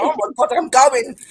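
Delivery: loud high panicked exit; scared bloke fleeing. Retold or imitated. Bloke running off from the investigators, loud panicked cry. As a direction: bolt in fright. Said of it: "He's like".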